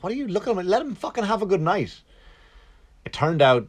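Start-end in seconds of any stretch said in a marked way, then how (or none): 0.0-2.0 higher, mock indignant; imitating inner outrage; retold indignant thought. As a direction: scold her in your head, half joking. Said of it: "I'm like".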